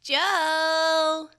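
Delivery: high loud creature call; creature crying out. A narrator's reading. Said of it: "it cried out".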